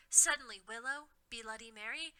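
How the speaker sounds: ordinary delivery, nothing marked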